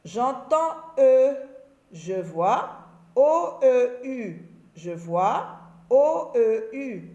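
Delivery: "je vois O E U" said very slowly, letter by letter; slow spelling out. Shown as a demonstration. Teacher to pupils, slowly spelling out O E U. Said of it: "spelling demo to pupils".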